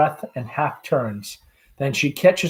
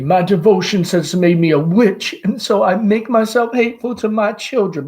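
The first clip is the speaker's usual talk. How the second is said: sobbingly; 7 semitones higher, 6 dB louder than usual. Acted.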